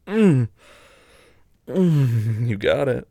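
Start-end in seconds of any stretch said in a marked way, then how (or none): 0.1-0.5 moan. Real, not acted. Arousal moan, loud.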